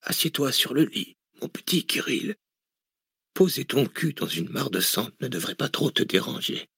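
put-on a frail old man needling his captor; weak dying old man. A narrator's reading. Dying billionaire taunts Cortès, frail mocking voice.

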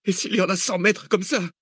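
high squeaky panicked question; squeaking, near panic. Acted. Cécile near a panic attack, squeaking. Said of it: "couina Cécile au bord de la crise".

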